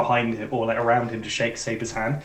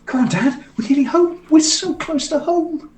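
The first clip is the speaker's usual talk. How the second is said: put-on high, loud kid calling to Dad; very high, eager little-kid voice; excited child; 6 dB louder than usual. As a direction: skip along piping up excitedly. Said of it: "Coven and Dovin are skipping".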